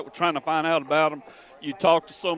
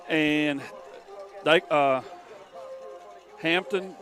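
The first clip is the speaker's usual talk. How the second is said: ordinary talk, nothing marked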